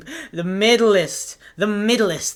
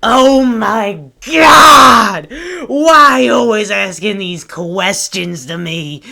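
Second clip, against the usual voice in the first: much louder, slow mock-outraged yell; exasperated outburst; 3 semitones higher, 11 dB louder, slower than usual. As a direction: blow up at her in exaggerated frustration. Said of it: "And I was like".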